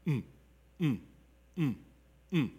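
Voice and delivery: short hummed rhythmic mm; humming rhythm layer. Shown as a demonstration. Teacher hums one layer of rhythm to demonstrate. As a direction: hum one steady layer. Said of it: "if I just give you one layer".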